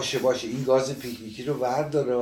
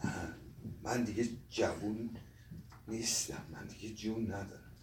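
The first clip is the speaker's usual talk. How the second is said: very soft, halting and tearful; choked up; 3 semitones lower, 10 dB quieter than usual. Acted. Old man lamenting he is no longer young, choked up. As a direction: trail off in helpless little pieces.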